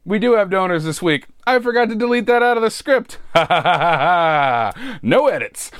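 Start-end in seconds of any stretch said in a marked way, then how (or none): 3.2-5.0 drawn-out laugh at own slip. Real, not acted. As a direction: laugh it off mid-read. Laughs at own script mistake.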